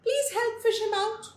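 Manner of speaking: high, urgent cry for help; breathless plea. A narrator's reading. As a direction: beg the old man, panting and frantic. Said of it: "he was almost out of breath".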